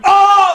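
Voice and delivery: loud, high "oh!" cry; startled yelp. Retold or imitated. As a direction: yelp out a shocked "oh!". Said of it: "like that".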